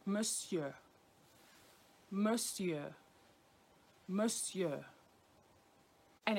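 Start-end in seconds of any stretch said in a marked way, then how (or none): 0.0-4.8 "Monsieur" said as muh-syuh, no r; French pronunciation. Shown as a demonstration. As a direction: repeat "Monsieur" clearly for learners. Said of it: "if you're saying Sir".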